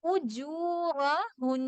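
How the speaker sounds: slow drawn-out tajweed recitation; measured Quranic chant. Shown as a demonstration.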